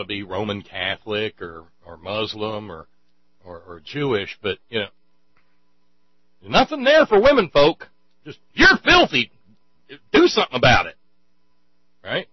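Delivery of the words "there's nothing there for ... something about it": high, loud hammed-up scolding; mock scolding outburst. Comedian voicing religion's contempt for women, mock scolding. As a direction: raise it to an exaggerated yell of disgust.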